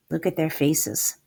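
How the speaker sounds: plain reading voice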